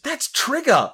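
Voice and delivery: high, delighted exclamation; excited recognition. Retold or imitated. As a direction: blurt it out like a thrilled kid. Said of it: "I did have a note which is just".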